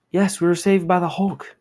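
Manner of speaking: a lightly voiced rescued civilian; grateful hostage. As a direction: gush with relief.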